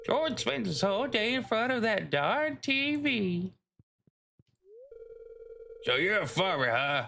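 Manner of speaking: lightly voiced grandma; old lady voice. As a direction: grumble like a sweet old lady. Voicing old lady NPC.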